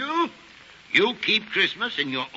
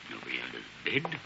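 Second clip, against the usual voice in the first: nervous mutter; 11 semitones lower, 9 dB quieter, much faster than usual. Acted. Scrooge nervously checks his room.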